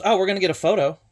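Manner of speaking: lightly voiced actress chirping the offer; cheerful high offer. Actress offers photo, retold.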